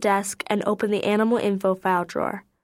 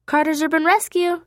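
out of breath; 7 semitones higher than usual. A narrator's reading. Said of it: "a little out of breath".

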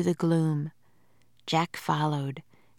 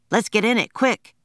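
urgent; 5 semitones higher, 5 dB louder than usual. A narrator's reading. Fleeing ants, urging.

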